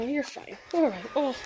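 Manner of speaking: normal talking voice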